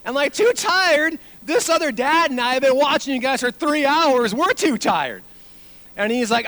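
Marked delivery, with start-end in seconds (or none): 0.3-5.2 loud, high, incredulous retort; exasperated outburst. Retold or imitated. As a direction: act out a fed-up dad losing it. Exasperated dad retorts to the kid.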